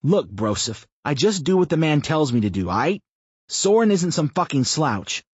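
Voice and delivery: brash rebuke. A narrator's reading. Chris snaps at Brian.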